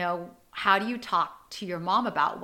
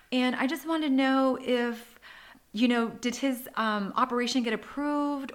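dramatic acting voice; 6 semitones higher than usual. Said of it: "so I was very dramatic".

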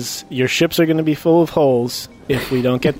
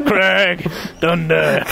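flexing hero voice; 3 semitones higher, much slower than usual. Acted. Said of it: "Name each of your biceps".

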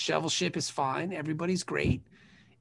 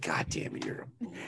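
exasperated mutter; 12 semitones lower, 9 dB quieter than usual. Retold or imitated.

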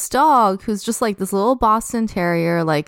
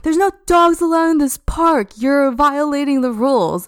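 higher and louder, mimicking an outraged rule-enforcer; imitated angry scolding; 6 semitones higher, 3 dB louder than usual. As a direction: play back her furious lecture about park rules. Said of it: "then she's like".

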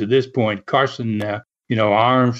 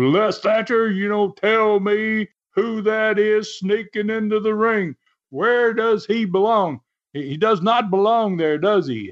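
high-pitched mock scream at usual volume; high, stretched mock outcry; retold screaming; 8 semitones higher than usual. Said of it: "he starts screaming right away".

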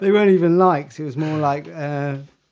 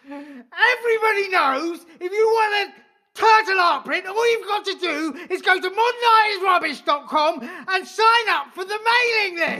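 high ranting detective; 9 semitones higher than usual. Acted. Detective rants at suspect.

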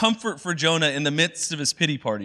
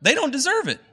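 much higher, outraged whine; indignant Jonah; 12 semitones higher than usual. Retold or imitated. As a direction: protest like a bitter prophet who can't believe it. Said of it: "He's like".

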